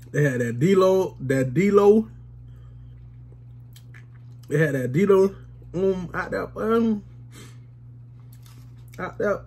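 cold food in mouth; slightly muffled eating speech; talking between bites. Real, not acted. Seen 2: Spoon and ice cream cup in hand; takes bites between phrases.

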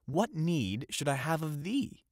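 mild scornful cry, pitched high. A narrator's reading. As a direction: exclaim it with a lifted voice. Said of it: "cried the young fisherman".

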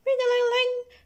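high, trilling bell noise; imitated bell. A narrator's reading. Bell ending lunchtime, imitated in a high voice. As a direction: ring it out high.

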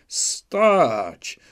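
loud, high shout of Stać!; barked command. A narrator's reading. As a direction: stop them dead with one loud order. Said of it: "krzyknął na swoich mirchorodców".

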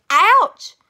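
loud, high yelp of pain; shouted. Acted. Onlookers get hit by the golf ball and yelp loudly. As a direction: cry out as if hit. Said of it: "people shouted the word of".

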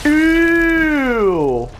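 long, high, loud squeal of disgust. Real, not acted. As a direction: recoil from gore with a drawn-out high cry. Disgust at gore in game.

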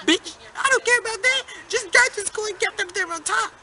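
high mock cop telling her off; mock scolding cop. Student imitates policeman scolding the bus driver, high mock voice.

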